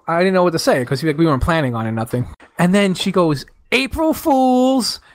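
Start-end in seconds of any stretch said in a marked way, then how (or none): 3.7-5.0 high, drawn-out imitation of wife; teasing reveal. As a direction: stretch it out high, taunting playfully. Wife reveals her prank.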